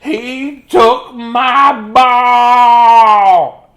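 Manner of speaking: very high, very loud, stretched wail; high dramatic pig howl; Piggy wailing. Acted.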